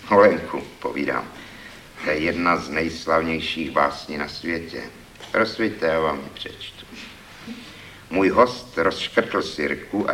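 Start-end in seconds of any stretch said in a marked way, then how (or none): none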